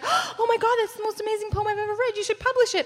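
high, fast, thrilled mother; gushing mom. Retold or imitated. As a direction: gush over it like a proud mom. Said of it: "she was like".

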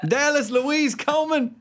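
Southern mama holler, high-pitched; Southern mom. Acted. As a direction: call out the full name in a Southern accent. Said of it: "The Southern accent seems to be".

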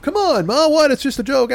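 high, whiny protest; mock innocent kid. Kid protesting to laughing mom, high and mock innocent. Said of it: "I'm like".

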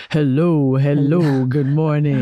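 deep, groggy morning hello; imitating low voice. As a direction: drop low to copy his sleepy greeting. Copies Mitch's low greeting.

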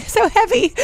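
breaking into a high laugh. Real, not acted. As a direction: laugh the words out. Laughs at own phrase.

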